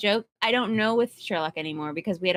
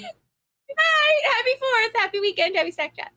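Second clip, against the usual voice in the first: high, bright shouted farewells fading down; excited goodbye; 12 semitones higher than usual. Real, not acted. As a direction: cheer each goodbye, starting very high. Signing off the stream.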